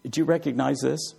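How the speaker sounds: usual speaking voice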